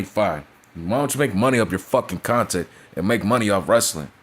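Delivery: ordinary talk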